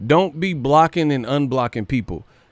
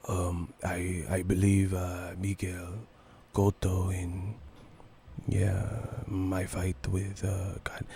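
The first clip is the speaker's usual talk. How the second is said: near-whisper, much softer and lower; Cotto whispering impression; 6 semitones lower, 12 dB quieter, slower than usual. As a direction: mumble it softly like a soft-spoken fighter at the mic. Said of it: "Cotto be whispering".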